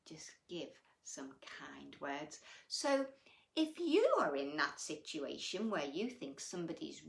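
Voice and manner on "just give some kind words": hushed, soft whisper. Real, not acted. Teacher urging children to be kind, whispered. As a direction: whisper it like a secret.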